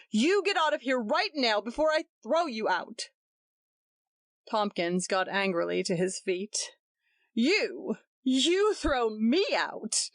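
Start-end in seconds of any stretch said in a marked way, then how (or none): 0.1-3.1 fierce angry threat. A narrator's reading. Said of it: "cried Roger fiercely". 7.3-10.1 very slow, higher disbelief; slow incredulous cry. A narrator's reading. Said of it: "he cried incredulously".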